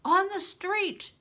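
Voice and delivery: high, loud and slow; boy answering Bear, still alarmed; urgent answer. Acted. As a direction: call it out urgently. Urgent reply during alarm.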